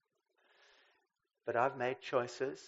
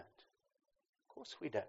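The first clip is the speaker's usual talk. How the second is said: softer, slow gentle echo; soft slow repeat; 7 dB quieter, much slower than usual. Real, not acted. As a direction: let it fall away tenderly.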